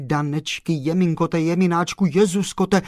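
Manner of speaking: ah-ing and oh-ing in rising alarm; fussing exclamations. Acted. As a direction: fuss over her like an anxious old woman. Character laments in exclamations.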